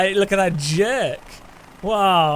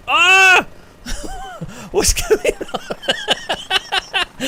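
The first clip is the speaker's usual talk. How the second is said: viel höher, überraschter Aufschrei; startled laughing; 5 dB louder, much slower than usual. Real, not acted. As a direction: erschrocken auflachen. Shocked at sudden in-game glitch.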